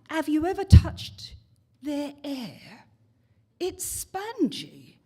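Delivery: high, slow mock Cockney lady; southern Cockney woman. English woman on the bus gossiping about the girls, mock Cockney. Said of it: "her southern Cockney voice".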